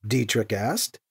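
plain reading voice

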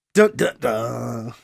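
slow, low sung dun-dun-dun; mock dramatic sting. Host teasing next week's chapter, mock dramatic sting. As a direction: ham up a cliffhanger sting.

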